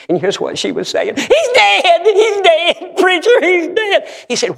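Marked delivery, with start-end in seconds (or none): 1.2-4.1 high-pitched frantic cry, much higher and louder; wife screaming the news to the preacher; retold screaming wife. Wife panicking on the phone, high frantic scream. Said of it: "she was screaming".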